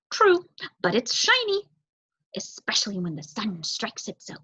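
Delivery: a bright bird pleased with his find; crow voice. Acted. Crow character voice.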